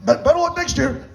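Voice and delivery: high, strained, croaky voice; Doc Rivers. Acted. Comic consoling after a loss, as Doc Rivers. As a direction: drag it out in a high, choked rasp. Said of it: "I sound like Doc Rivers in a way".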